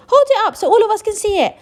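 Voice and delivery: high, loud demand; crowd shout. Acted. Crowd member demands to see ticket, loud. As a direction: shout over everyone.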